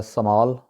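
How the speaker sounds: स्मॉल with a long "aa", su-maal; wrong local pronunciation. Shown as a demonstration. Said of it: "ये गलत है".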